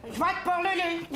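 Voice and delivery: squeaky-high put-on voice. Man disguising his voice on a vote call, very high voice. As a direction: push the voice right up high. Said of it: "avec une voix ben, ben, ben aiguë".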